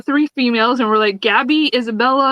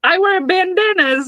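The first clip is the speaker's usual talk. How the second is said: high, slow hammed-up tough guy; mock tough guy; 9 semitones higher than usual. [name] pretending to be tough Emmett, hammed up. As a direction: puff up, slowly, as a fake tough guy. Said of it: "I'm a tough guy that wears bandanas".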